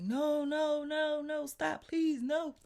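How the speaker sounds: high, loud panicked imitation; retold alarmed plea. Imitating the landlord rushing in on the fight, alarmed and loud. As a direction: cry out in mock alarm to halt a fight.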